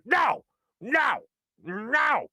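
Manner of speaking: high mock toddler shout of no; angry toddler. Dad imitating his angry little daughter, high mock shout. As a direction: stamp out no like a cross two-year-old. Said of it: "And she's like".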